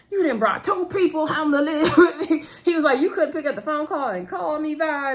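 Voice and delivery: annoyed Hollywood. Acted. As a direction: play the put-out husband, half laughing. Hollywood complains to Vi.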